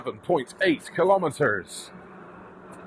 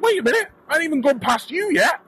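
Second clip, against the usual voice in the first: indignant disbelief; 10 semitones higher than usual. Retold or imitated. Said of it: "And I was like".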